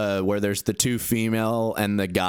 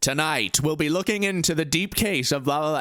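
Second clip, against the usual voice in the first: higher-pitched mock news anchor; lightly put-on anchor patter; Russell Brand newscasting; 7 semitones higher than usual. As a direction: imitate someone hijacking a news intro. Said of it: "he starts doing the news for him".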